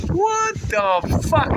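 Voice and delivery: high, slow startled outcry. Real, not acted. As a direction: blurt it out, caught by the gust. Gust of wind hits him.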